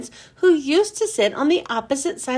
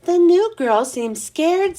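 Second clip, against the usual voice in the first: ordinary delivery, nothing marked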